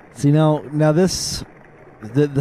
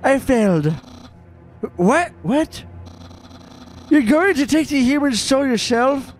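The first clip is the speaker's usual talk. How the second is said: high-pitched little monster reporting to his boss; high squeaky character voice; 10 semitones higher than usual. Pretend. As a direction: squeak it out nervously, like a timid minion. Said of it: "this voice makes me so out of breath".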